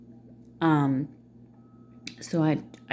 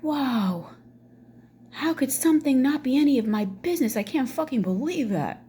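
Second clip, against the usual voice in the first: higher, hammed-up incredulous outrage; mock disbelief; 7 semitones higher than usual. Mother whose business it supposedly is, mock incredulous. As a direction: play up the scandalized disbelief.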